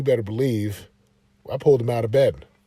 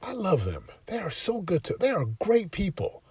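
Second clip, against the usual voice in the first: soft fatherly talk; 6 semitones higher, 4 dB quieter than usual. Retold or imitated. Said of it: "I said".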